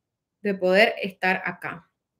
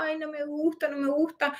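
whiny complaint; 7 semitones higher, 6 dB quieter than usual. Retold or imitated. Quoting her early complaining self.

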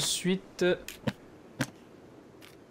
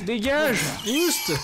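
shooing shout; 7 semitones higher, much slower than usual. Real, not acted. Shooing enemies away.

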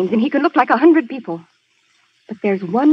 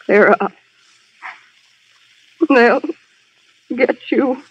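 dying words. Acted. Stabbed woman gasping her last words.

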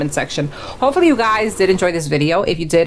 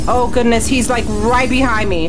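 being chased; 5 semitones higher than usual. Real, not acted. Chased through the doors.

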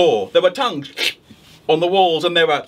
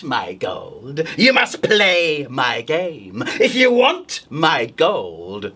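slow, creepy drawn-out witch; witch riddle; 4 semitones lower, much slower than usual. Acted. Witch to the wolf, slow creepy riddle. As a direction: drag out the riddle like a sly old witch. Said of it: "the witch said".

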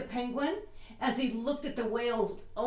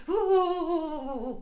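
shivering; 5 semitones higher than usual. Shown as a demonstration. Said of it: "can you shiver?".

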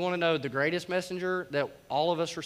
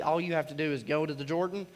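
ordinary talk, nothing marked

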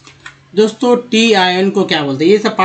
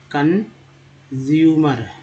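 Consumer stretched slowly, each syllable held; extra slow model; very slow demo; much slower than usual. Shown as a demonstration. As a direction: draw out consumer for learners. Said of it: "pronunciation demo".

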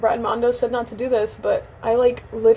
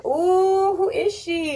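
high, drawn-out playful coo; mock admiration; 7 semitones higher, much slower than usual. Vlogger finding breakage in her hair, mock admiration. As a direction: pitch it up in mock awe.

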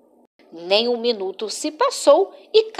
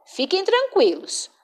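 ordinary delivery

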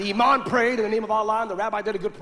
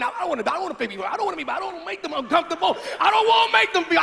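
panicked outburst; 7 semitones higher, much faster than usual. Retold or imitated. Panicking before praying onstage.

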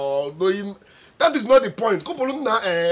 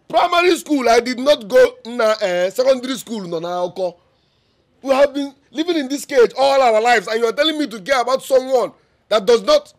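higher, louder, heated complaint; angry outburst; 4 semitones higher, 5 dB louder than usual. Acted. Son shouting at his mother about growing up poor. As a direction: let the grievance boil over.

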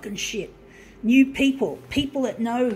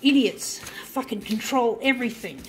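ordinary talk

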